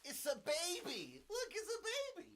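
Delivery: very high put-on baby-talk voice; playful falsetto cooing; cutesy squeal. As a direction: coo it in a tiny high voice. Plays at finding a baby.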